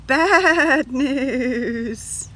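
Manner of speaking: whiny fake sob, drawn out; mock crying. Shown as a demonstration. Teacher reading to children, hammed-up whimper. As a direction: pretend to cry over it.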